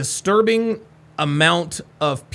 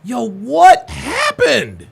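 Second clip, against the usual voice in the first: higher, louder, drawn-out cries of shock; stunned disbelief; 8 semitones higher, 5 dB louder, much slower than usual. Real, not acted. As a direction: gasp out questions in disbelief. Still shocked replaying clip.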